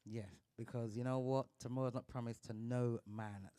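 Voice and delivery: ordinary delivery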